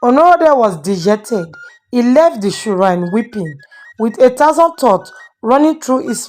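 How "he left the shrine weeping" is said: plain reading voice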